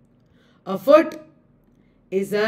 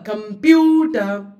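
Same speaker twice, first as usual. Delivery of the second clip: Computer with PU stretched out; longer stressed syllable; 3 dB louder than usual. Shown as a demonstration. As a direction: hold the middle of comPUter long. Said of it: "It is longer".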